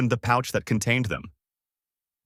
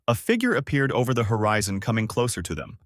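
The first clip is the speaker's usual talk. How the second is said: ordinary delivery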